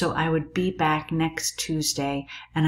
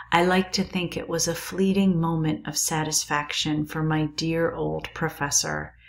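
ordinary delivery

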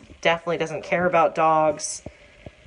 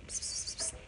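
whispered cat call; 16 dB quieter than usual. Real, not acted. Calling cat with psst.

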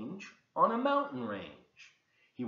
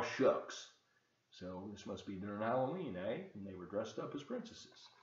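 ordinary delivery

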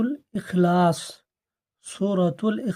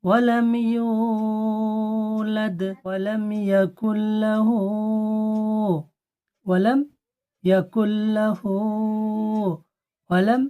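slow melodic tajweed chant; Quran recitation; 3 semitones higher, 3 dB louder, much slower than usual. Shown as a demonstration. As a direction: recite each verse slowly, repeating. Recitation drill. (ধীর সুরে তিলাওয়াত)

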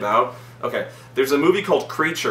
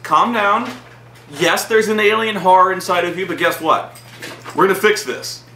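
raised, emphatic placating voice; mock reassurance; 6 semitones higher, 4 dB louder than usual. Robot calming the pregnant scientist, mock reassurance. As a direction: placate them loudly, imitating the robot.

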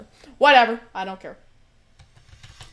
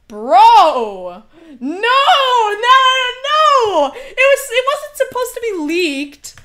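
high, loud laughing protest; outraged yelling; 12 semitones higher, 10 dB louder, much slower than usual. Real, not acted. Streamer wailing at chat over the leak, high and loud. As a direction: wail that it wasn't meant to leak.

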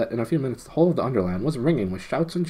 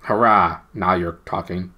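loud cheering hurrah; cried out; 2 semitones lower, 6 dB louder than usual. Acted. Eustace cheering the gnome's news, loud. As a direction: cheer it out gladly. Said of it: "cried Eustace".

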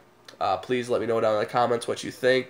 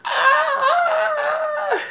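very high, laughing mock shriek; pained shriek; 3 dB louder than usual. Friend twisting his nipples for a photo, high mock shriek. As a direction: yelp like your nipples are twisted. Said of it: "He was like".

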